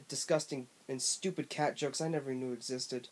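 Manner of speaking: usual speaking voice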